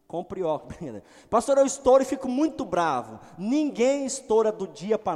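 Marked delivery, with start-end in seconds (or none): 1.3-3.2 high and loud whiny complaint; mock hothead congregant. Congregant confessing his temper to the pastor, loud and high. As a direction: complain like an exasperated believer.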